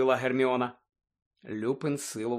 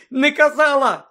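high, loud, slow shriek; 13 semitones higher, 11 dB louder than usual. A narrator's reading. Hermione to Lupin, furious, shrieking high and loud. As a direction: shriek it back at him. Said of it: "заверещала Герміона".

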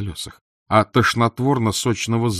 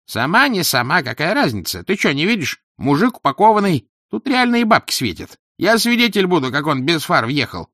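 loud, high, pushy bystander; businesslike tenor; 9 semitones higher, 5 dB louder than usual. A narrator's reading. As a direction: press the scheme in a raised, high voice. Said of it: "деловитый тенор".

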